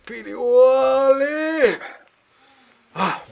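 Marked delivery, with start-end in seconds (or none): 0.1-1.8 big stretching yawn shout, high, loud and slow; a loud, drawn-out yawning cry. Shown as a demonstration. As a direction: stretch out Pili Wali! as one long loud yawn. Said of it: "you do a big yawn like this".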